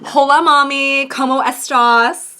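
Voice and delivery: hammed-up Spanish greeting on a call to mom; mock Spanish phone greeting.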